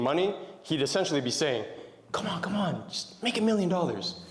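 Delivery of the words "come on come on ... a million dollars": higher, lightly voiced teasing brother; a hint of a brother, made absurd on purpose; lightly hammed-up sibling voice; mock big brother. Mock version of brother's line.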